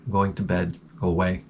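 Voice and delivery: low, soft, half-asleep brush-off; sleepy mumble. Retold or imitated. Sleepy reply to dog.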